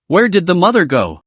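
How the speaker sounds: ordinary delivery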